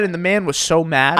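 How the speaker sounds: ordinary talk, nothing marked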